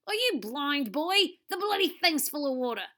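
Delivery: exasperated Aussie snapping from a tree; irritated Australian accent. Acted. TV star scolds Wahoo, irritated Australian accent.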